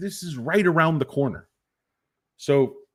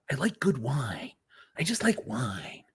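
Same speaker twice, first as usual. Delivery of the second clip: a mock whispered confession; 8 dB quieter than usual. The imagined CEO confessing his love of wine, whispered. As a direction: breathe it out in a whisper.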